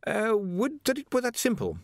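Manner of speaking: high, stammering anxious scholar; a jittery young wizard stammering high; nervous wizard. A narrator's reading. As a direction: stumble over every word, flustered. Ponder answers.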